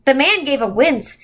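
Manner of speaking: usual speaking voice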